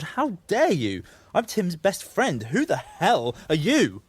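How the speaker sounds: high, slow, outraged emphasis; retold indignant outburst. Sam reliving pounding on the door, indignant.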